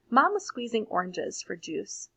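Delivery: plain reading voice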